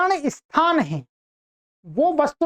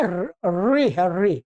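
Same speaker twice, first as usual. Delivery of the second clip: ordinary talk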